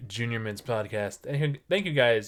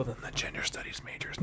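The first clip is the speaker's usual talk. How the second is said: conspiratorial whisper; whispered aside; 9 dB quieter than usual. Real, not acted. Host whispering to history majors that they're safe, conspiratorial whisper. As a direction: whisper it like a secret reassurance.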